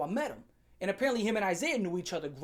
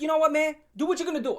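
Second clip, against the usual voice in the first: higher and louder, fed up; mock angry retort; 7 semitones higher, 5 dB louder, faster than usual. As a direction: snap back at the coach, done with it. Yelling back at the coach.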